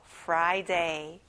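"Friday" with the R clearly pronounced; careful R sound. Shown as a demonstration. Teacher asking children to repeat, "Friday" with a careful R. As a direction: focus on the R in "Friday". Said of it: "R 발음을 집중해서 해보세요".

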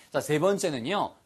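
usual speaking voice

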